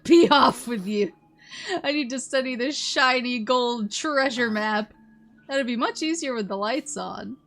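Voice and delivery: loud, blustering sea captain; pirate captain voice. Acted. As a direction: bellow like a grumpy pirate captain. Pirate captain answers loudly.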